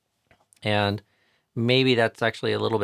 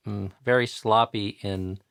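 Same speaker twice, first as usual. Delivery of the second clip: normal talking voice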